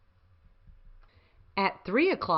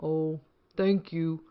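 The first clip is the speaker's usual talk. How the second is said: tired; 2 semitones lower, slower than usual. Acted. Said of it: "said the wet, tired, and hungry ladybug".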